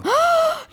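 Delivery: sharp high gasp; shocked gasp. Real, not acted. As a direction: gasp in mock horror. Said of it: "People named Melissa".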